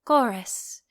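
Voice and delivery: plain reading voice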